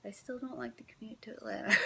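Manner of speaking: a confiding whisper to the viewer; whispered aside. Real, not acted. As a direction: let it slip out under your breath.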